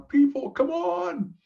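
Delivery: high, loud, slow "come on, people"; exasperated plea. Retold or imitated. Pleading with business people to see it, loud and high. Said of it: "I'm going".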